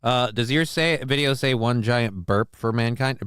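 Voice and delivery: ordinary talk, nothing marked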